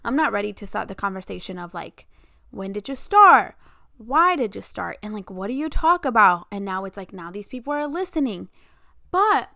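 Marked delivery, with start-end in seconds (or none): 2.5-6.5 louder, rapid-fire imitated questions; mock loud grilling. Imagined nosy people quizzing her about the podcast, loud and pushy.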